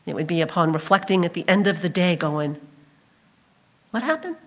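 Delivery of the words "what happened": soft, high, rising question; quiet mock bewilderment, pitched way up; puzzled. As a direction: wonder aloud in bafflement. Crowd's imagined puzzled afterthought.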